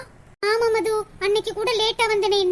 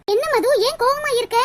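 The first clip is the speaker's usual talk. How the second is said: normal talking voice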